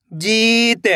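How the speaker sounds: ordinary talk, nothing marked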